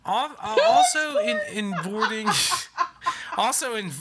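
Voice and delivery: giggling through words; laughing. Real, not acted. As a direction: break up laughing mid-line.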